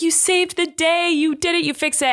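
retold delighted praise for his son; proud cheering. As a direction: cheer him on like a proud dad. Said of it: "And Eric was like".

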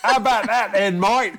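high, hammed-up Cockney; bad Cockney accent. Shown as a demonstration. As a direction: show off a terrible Cockney accent. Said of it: "that was the worst Cockney accent ever".

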